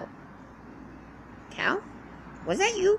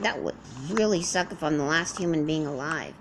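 normal talking voice

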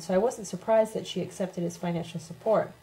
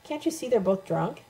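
begging; 5 semitones higher than usual. A narrator's reading. Tina pulls Sophia away from drunk hosts, begging. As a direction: plead with her to let it go. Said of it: "pulled me by the arm and begged".

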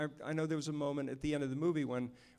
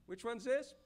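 imitating director; 8 semitones higher, 4 dB quieter than usual. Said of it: "and he'd go like".

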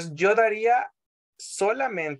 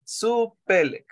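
Supelek said slowly and a bit higher; slow model word; 4 semitones higher, slower than usual. Shown as a demonstration. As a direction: model Supelek clearly for the class. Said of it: "que sería supelek".

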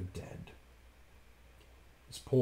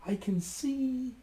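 much higher, slow; excited sister; 13 semitones higher, 3 dB quieter, slower than usual. A narrator's reading. Sister Anne spotting riders, excited. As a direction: draw it out with rising hope. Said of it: "she replied".

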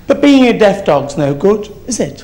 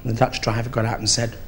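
ordinary delivery

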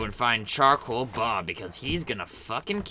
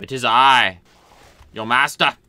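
usual speaking voice

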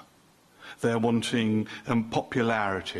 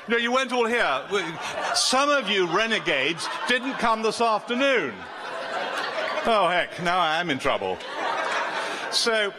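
playful teasing; 7 semitones higher than usual. Real, not acted. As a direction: playfully scold the ones who skipped, laughing. Teasing absentees from afternoon session.

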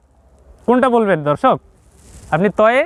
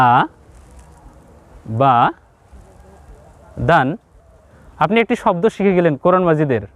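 usual speaking voice

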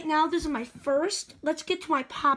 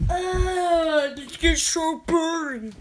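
bored groan; 5 dB louder, much slower than usual. Real, not acted. Bored groan at the repeated dance.

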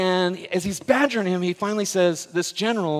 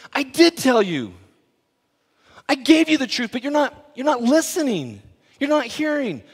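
Jesus replying; 8 semitones higher, 5 dB louder than usual. Retold or imitated. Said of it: "He goes".

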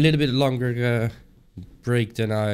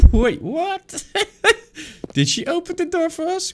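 high, giggling astonished questions; can't believe what just happened; laughing disbelief. Real, not acted. Gamer to viewers about the door opening, high amazed laughter. Shocked by game event.

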